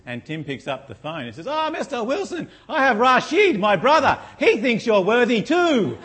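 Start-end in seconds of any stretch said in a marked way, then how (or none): 1.5-6.0 much higher, louder hammed-up Indian accent, laughing, shouting; mock call centre accent. Preacher plays the salesman calling back with his brother. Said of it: "Chinese, Indian, yeah, I have to practice that one".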